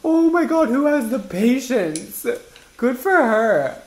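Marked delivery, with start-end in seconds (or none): none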